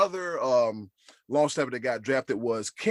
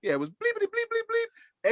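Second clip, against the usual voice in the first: squeaky high bleeped swearing, much higher; mock happy cussing. Host imitating the drafted player's joyful cursing, high squeaky bleeps. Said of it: "Sometimes you happy cuss".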